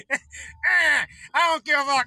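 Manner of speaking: hammed-up sulky outburst; imitating a petulant man-child yelling; Trump impression. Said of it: "That's my impression".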